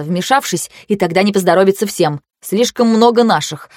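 usual speaking voice

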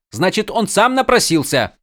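high, snide retort; snapping back. A narrator's reading. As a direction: snap back like a spoiled prince. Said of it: "Огрызнулся Адалин".

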